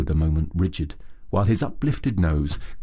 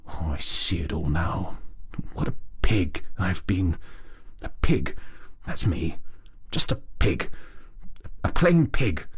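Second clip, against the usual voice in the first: gloomy mutter. A narrator's reading. Rat blaming himself for ignoring Mole, gloomy mutter. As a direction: mutter it to yourself in shame. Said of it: "he muttered gloomily".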